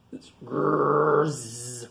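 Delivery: imitated buzzing snores; repeated storybook snores with long hissing out-breaths; loud snoring. A narrator's reading. Grizzly Grump asleep in his cave, snoring. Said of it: "His loud snores echo out".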